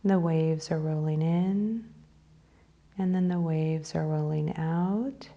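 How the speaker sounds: unhurried phrases, leaving space to breathe; guided meditation. Real, not acted. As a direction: lead them gently into breathing and imagery.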